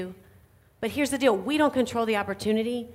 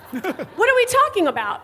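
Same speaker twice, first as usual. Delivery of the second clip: high curious question; 9 semitones higher, 5 dB louder than usual. Retold or imitated. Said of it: "retold chat with husband".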